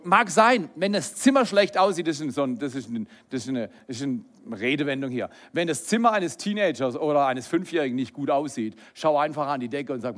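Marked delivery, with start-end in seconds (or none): none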